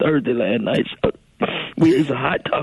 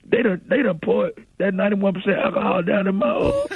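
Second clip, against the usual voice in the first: pained cry; 9 semitones higher, slower than usual. Real, not acted. Alcohol wipes burning, in pain.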